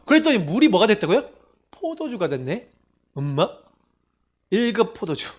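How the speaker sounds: ordinary talk, nothing marked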